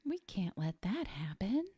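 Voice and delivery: hushed whisper; whispered worry. Retold or imitated. Friend whispering Cornelia's worry, hushed whisper. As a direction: whisper it like a secret plan.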